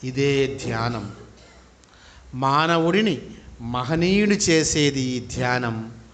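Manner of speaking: slow, drawn-out meditation guidance; measured phrases with long settling pauses; guided meditation. Real, not acted. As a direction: lead them into breath awareness, unhurried.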